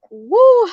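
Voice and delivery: high, loud "woo" to let tears out; sharp high release while crying; tearful woo. Real, not acted. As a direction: blow off emotion with a high whoop. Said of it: "I'm sorry for crying".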